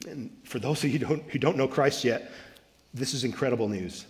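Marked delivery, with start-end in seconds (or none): none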